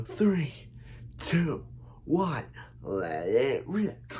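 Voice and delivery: countdown call. Acted.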